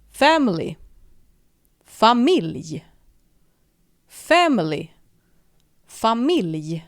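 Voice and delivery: Familj with an open a and a final j, Family with an English short a; alternating Family and Familj. Shown as a demonstration. Teacher drilling the Swedish and English pair. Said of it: "the word for family".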